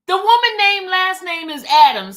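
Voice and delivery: loud, high correction; loud insistence. Real, not acted. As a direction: hammer home the name.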